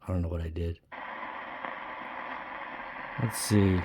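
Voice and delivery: normal talking voice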